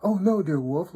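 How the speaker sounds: innocent little daughter. A narrator's reading. Little daughter denying to the wolf that she moved, high innocent voice. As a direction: protest innocence in a high sing-song child voice. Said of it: "little daughter tells him".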